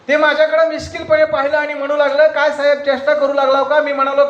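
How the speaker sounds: high, loud recitation; declaimed poem lines. Acted. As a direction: proclaim the verse to the audience. (उंच मोठ्या आवाजात कविता)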